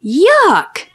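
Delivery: high, disgusted storybook yelp; mock scream. A narrator's reading. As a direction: yelp it high without real volume. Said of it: "screamed Billy".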